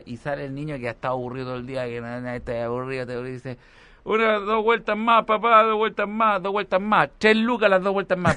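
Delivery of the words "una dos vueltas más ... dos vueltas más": put-on high, loud pleading child; begging kid. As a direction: beg dad like a child. Kid begging father for rides.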